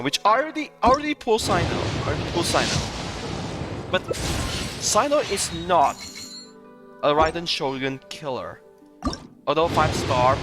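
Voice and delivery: usual speaking voice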